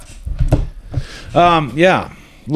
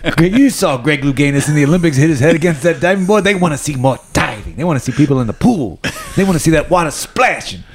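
cigar in mouth; 4 semitones higher than usual. Retold or imitated. Pitching Rodney Dangerfield diving movie.

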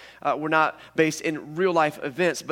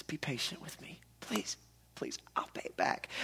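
whispered, desperate begging; pleading whisper; 13 dB quieter than usual. Acted. Servant begging the master for time, whispered. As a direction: beg for mercy under your breath.

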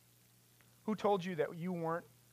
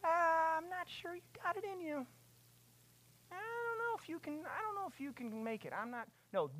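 doubting voice; 12 semitones higher, 4 dB quieter than usual. Retold or imitated. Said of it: "what's the voice that he hears".

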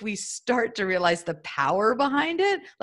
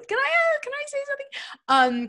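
high, playful mock-schoolkid plea; pupil asking permission. Guest jokingly raises her hand to ask, in a high pupil voice.

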